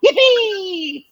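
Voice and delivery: high, excited Mario yippee. Retold or imitated. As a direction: squeal it like Mario. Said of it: "and I'll go".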